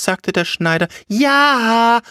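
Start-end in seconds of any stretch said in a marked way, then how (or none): 1.1-2.0 loud, very high drawn-out character voice; scarecrow voice. A narrator's reading. Scarecrow answers the tailor, high and loud. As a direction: squeal it out as the scarecrow. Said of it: "sagte die Vogelscheuche".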